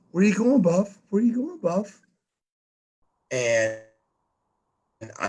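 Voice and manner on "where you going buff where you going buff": high, singsong mock of the guys asking; high mocking chorus. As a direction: sing it up high like a teasing crowd. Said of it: "fifty guys going".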